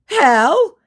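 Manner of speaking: high, loud nervous yelp; mock nervous. Impostor asked for a Korean accent, mock nervous. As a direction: act out his rattled question. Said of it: "getting very nervous".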